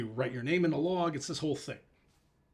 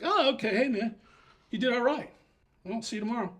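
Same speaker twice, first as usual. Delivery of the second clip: much higher breezy voice; casual praise; 8 semitones higher than usual. Retold or imitated. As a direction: toss off a casual compliment. Fast runner praises him.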